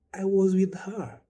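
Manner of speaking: high, loud and stretched out; mock insistence. Acquaintance insisting to the bereaved, slow high-pitched mock protest. Mimics people claiming recent contact.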